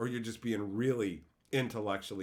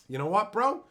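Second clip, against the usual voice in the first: usual speaking voice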